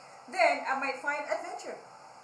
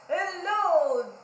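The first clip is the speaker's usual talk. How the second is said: calling down; 9 dB louder, much slower than usual. Acted. Pig on stilts calling down to Zebra. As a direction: call down to someone far below. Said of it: "they called to Zebra".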